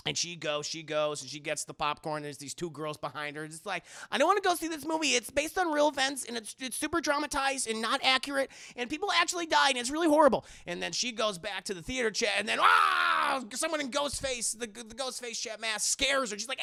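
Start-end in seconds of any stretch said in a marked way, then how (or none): 4.1-10.5 high, chattering put-on voice; mock complaining girl. Voicing two girls behind her. 12.6-13.4 loud, high, drawn-out fright yell; mock scream. Scare as she returns.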